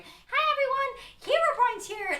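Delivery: put-on very high, slow, excited kid streamer; kid YouTuber voice. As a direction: squeal it like a young kid on his phone.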